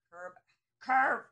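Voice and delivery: hammering the point home loudly; sudden loud exclaimed repeat; emphatic shout. Real, not acted. Stressing exact stopping point.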